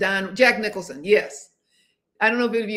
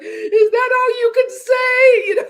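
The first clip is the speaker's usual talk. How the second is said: much higher, loud, slow tearful outcry; retold movie heroine shouting at him from the taxi; imitating a crying shout; 13 semitones higher, 8 dB louder, slower than usual. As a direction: hurl it at him through tears. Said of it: "she's like".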